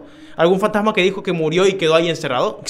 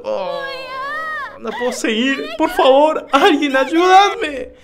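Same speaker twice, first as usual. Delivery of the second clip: desperate plea; 9 semitones higher, slower than usual. Acted. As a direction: drag out a desperate cry for help. Voicing the girl pleading for help. (súplica desesperada, aguda, fuerte y muy lenta)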